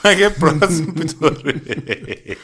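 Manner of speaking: repeats it through laughter; slow, laughing echo; laughing mimicry. Repeating friend's line laughing.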